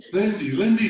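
high, loud frantic calling; loud high alarmed calling; shriek. A narrator's reading. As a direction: shriek a fainted friend's name. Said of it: "shrieked Molly".

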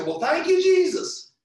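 high, ringing "thank you, Jesus"; loud praise. Retold or imitated. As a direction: drown out the cussing with praise. Answering coworkers' cussing.

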